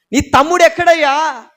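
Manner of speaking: loud, high demand of God; God's stern question. Acted. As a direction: call out to Cain accusingly.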